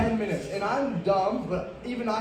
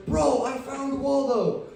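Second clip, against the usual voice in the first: excited; 5 semitones higher, slower than usual. Retold or imitated. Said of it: "wakes up and goes".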